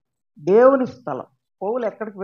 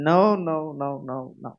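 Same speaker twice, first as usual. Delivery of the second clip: God refusing; 7 semitones lower, 4 dB quieter, much slower than usual. Retold or imitated.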